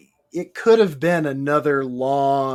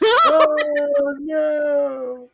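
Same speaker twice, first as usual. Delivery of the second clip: high, long drawn-out wail; mock dismay; 12 semitones higher, 4 dB louder than usual. Reacting to the Sarah Jessica Parker jab, mock dismay. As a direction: stretch it out high in mock horror.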